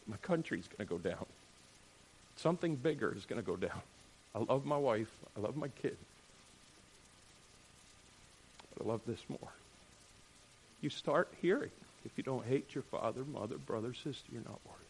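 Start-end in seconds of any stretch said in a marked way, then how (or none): 0.1-9.5 much softer and lower, steady; quiet, weighty devotion; soft resolve. Retold or imitated. Hostage family resolve. 12.0-14.8 much softer, fading grave words; soft solemn quote. Retold or imitated. Quoting Jesus.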